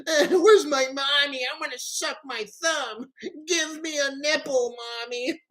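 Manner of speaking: high whiny baby voice with fake sobs; mock crybaby. Mocking the host as a crying baby, high and whiny. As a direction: wail like a toddler wanting mommy. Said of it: "asking for".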